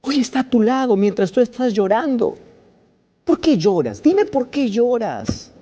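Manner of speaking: impassioned altar call; fiery preaching. Acted. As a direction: preach it at full force from the pulpit. Preacher building to full force.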